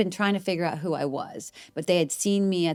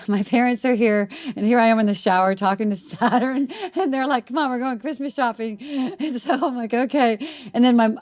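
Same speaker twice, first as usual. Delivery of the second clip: higher-pitched, giggly telling; laughing while talking; 6 semitones higher, 4 dB louder than usual. Real, not acted. Woman telling of parents interrupting her Saturn talk, laughing. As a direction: tell it through rising laughter.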